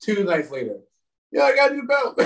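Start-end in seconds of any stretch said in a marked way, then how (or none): 1.3-2.2 high, breezy yeah, I got a new belt; mock nonchalant brag. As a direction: toss off the win like nothing. Retelling her win after food poisoning.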